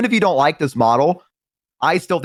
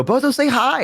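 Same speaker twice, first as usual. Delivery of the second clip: higher-pitched cue to the chat; cheery call-out; 11 semitones higher than usual. Acted. Streamer prompting chat to greet a newcomer, cheery call-out. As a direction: call to your crowd in a lifted voice. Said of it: "Yeah, I always go".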